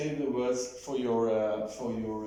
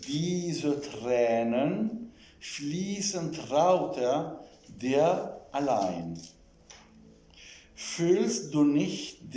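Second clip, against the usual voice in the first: slow, carefully spaced German text reading; measured model reading with pauses between phrases; slow dictation; slower than usual. Shown as a demonstration. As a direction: read it out slowly, word by word, with long vowels. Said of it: "say it slowly".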